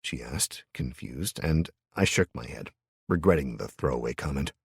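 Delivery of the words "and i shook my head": ordinary delivery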